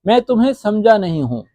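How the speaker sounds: ordinary talk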